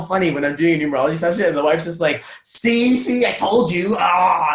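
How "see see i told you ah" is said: high, drawn-out mock gloating; triumphant told-you-so. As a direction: crow it like a vindicated wife. Acting triumphant wife in session.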